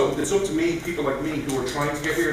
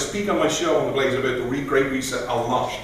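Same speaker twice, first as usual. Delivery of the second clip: normal talking voice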